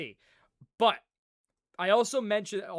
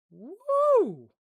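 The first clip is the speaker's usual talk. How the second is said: joyful woo; 8 dB louder than usual. Real, not acted. After cracking back.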